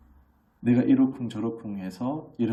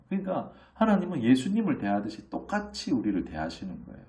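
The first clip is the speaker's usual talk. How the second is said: usual speaking voice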